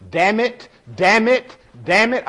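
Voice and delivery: high, loud mock cussing; imitating little girl. Three-year-old at the steering wheel copying her dad, high loud mock cussing. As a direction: play a toddler copying a cussing driver. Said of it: "grabbed the steering wheel and went".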